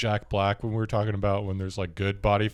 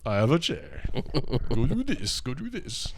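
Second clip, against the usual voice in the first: a hint of a haughty queen on her throne; high, lightly voiced imperious commands; mock royal; 4 semitones higher than usual. Woman playing queen on her grandma's fancy chair, lightly haughty. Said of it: "I almost did the King in the Castle".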